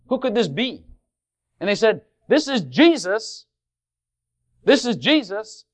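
high, loud storytelling sermon; preacher retelling Jesus' entry with fervour; fervent preaching. Acted. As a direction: carry the congregation into the scene.

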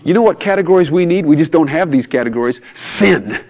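loud, high, exasperated outburst; imitating a fed-up psychologist; frustrated. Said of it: "he was so frustrated, he said".

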